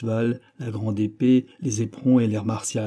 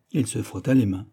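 plain reading voice

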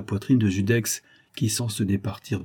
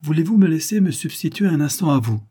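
shrewd; 7 semitones higher, 4 dB louder than usual. A narrator's reading. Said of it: "proposait finement Judex".

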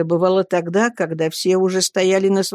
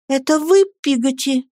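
startled question; 6 semitones higher than usual. A narrator's reading. Boy reaching out in the dark to his nanny, high startled question. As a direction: ask it aloud, voice lifted.

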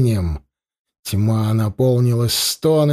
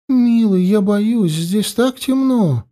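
put-on scared young woman; 8 semitones higher, slower than usual. Frightened beloved girl speaks.

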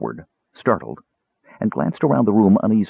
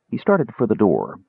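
usual speaking voice

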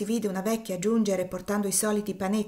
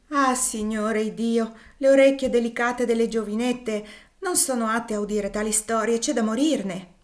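put-on cook protesting the girl heard it; lamenting cry over the child's ears; dismayed lament; 4 semitones higher, 6 dB louder than usual.

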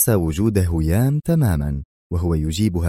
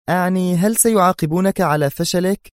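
high, emotional female voice; agitated question; 11 semitones higher than usual. A narrator's reading. She asks if he will be punished, agitated and high. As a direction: press him in high-pitched agitation. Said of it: "سألته في انفعال".

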